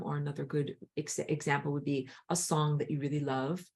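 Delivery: ordinary delivery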